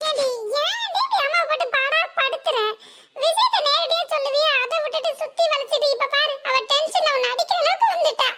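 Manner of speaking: high, laughing exasperation; exasperated elder sister. Acted. Elder sister tells mother to stop hitting her sister, high and exasperated. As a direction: plead with mother, mock the sister. Second daughter protests to mother.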